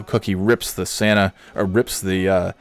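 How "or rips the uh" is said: ordinary delivery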